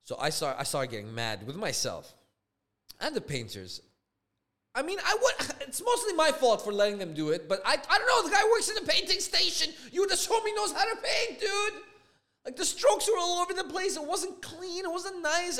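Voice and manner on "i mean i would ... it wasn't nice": high-pitched, half-laughing disbelief; exasperated rant. Real, not acted. Homeowner blaming himself and clueless painters, incredulous rant.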